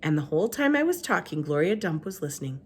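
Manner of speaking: ordinary delivery, nothing marked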